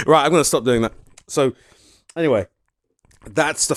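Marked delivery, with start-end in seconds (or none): none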